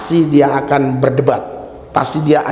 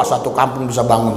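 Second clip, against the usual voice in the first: ordinary delivery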